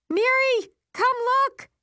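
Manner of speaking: high, loud call to a sister; excited scream. A narrator's reading. Narrator calls sister to come look, excited scream. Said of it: "I scream".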